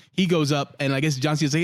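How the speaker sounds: ordinary talk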